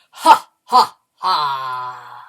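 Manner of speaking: loud, spooky "ha, ha, haaa" dropping in pitch; ghostly laugh. Shown as a demonstration. Teacher demonstrating for the class, ghostly laugh. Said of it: "A very ghostly laugh".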